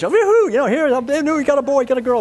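hammed-up celebration of a newborn; mock cheering crowd. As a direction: cheer like a delighted family at a birth. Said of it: "everybody cheers".

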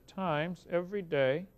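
slow calculator entry. Retold or imitated. Punching numbers into calculator.

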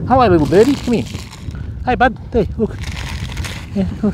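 high sing-song coaxing to a little bird; pet talk. Real, not acted. Sweet-talking a bird to come close.

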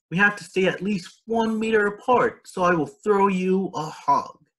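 put-on high, bright child calling out; cheerful call. A narrator's reading. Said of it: "she cried".

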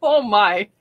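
ordinary talk, nothing marked

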